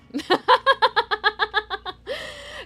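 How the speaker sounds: high, loud theatrical cackle that falls away; dramatic laugh. Real, not acted. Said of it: "I'm feeling extra dramatic today".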